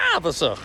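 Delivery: wavasach with a long stressed second vowel, laughing at it; mispronounced, mocking. Shown as a demonstration. Coach repeating the bad version for students, amused. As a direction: Wavasach said the wrong way with a drawn middle vowel. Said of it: "with a lengthened second vowel".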